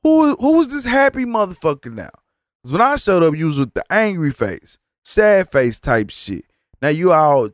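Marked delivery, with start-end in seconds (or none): 0.0-2.1 who is this suddenly cheerful person; incredulous. Retold or imitated. Seeing the cashier suddenly chipper, high incredulous outburst. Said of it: "I'm like".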